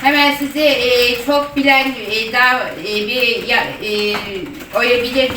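eager transfer to a colleague; customer service voice. Acted. Agent transferring call.

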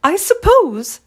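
mama giving in, pitched high; high, indulgent mother's consent; reluctant sigh. A narrator's reading. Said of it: "Mama sighed".

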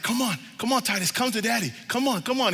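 high-pitched, eager coaxing; coaxing dad. Retold or imitated. Dad to his baby taking first steps, coaxing. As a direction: urge a toddler toward you.

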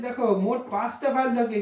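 ordinary talk

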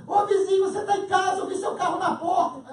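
loud, high call through the door; woman calling her neighbor through the door, loud and high. Retold or imitated.

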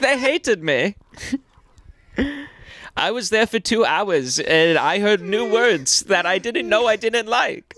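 high, wounded mock-Swedish voice; Swedish kid accent. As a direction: crestfallen exchange student with a Swedish accent. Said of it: "Just some fucking Swedish".

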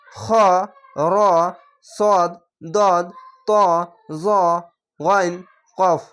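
খ, র, সদ, দদ, ত, জ, গইন, কফ each rounded with aw, no aa; spaced, thick letter recital from খ to কফ. Shown as a demonstration. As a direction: recite the eight letters heavily, one by one. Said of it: "মোটা করে পড়তে হবে".

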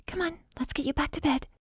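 soft and high, gently coaxing; softly. Acted. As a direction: ease him back to bed, softly. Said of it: "I said softly".

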